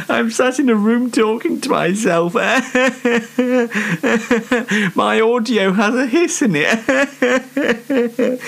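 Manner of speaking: self-mocking laughter, barely holding it together; laughing at himself. Real, not acted. Host alone on his show laughs at having no guests, giggling.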